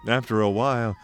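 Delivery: plain reading voice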